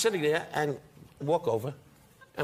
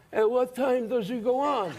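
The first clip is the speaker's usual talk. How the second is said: slow, mock menacing ringside question; gangster voice; 8 semitones higher, slower than usual. Mobsters at the ringside asking when he goes on, slow mock menace. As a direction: lightly play a tough guy at ringside. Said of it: "sat ringside going".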